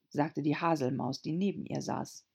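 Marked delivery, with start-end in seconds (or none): none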